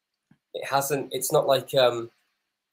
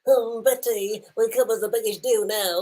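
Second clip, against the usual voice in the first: much higher put-on voice for the hero; mock character voice; 11 semitones higher than usual. Viewer putting words in the hero's mouth, high mock voice. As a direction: ham up the hero's line.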